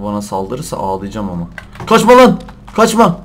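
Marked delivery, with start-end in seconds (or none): 1.9-3.2 loud high yell at a runaway; loud desperate chase cry; shouting after it. Real, not acted. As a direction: call it to stop, loudly. Chasing a fleeing deer.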